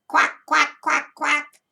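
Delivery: loud, high quacking; duck quack. Shown as a demonstration. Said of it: "I think a duck sounds like this".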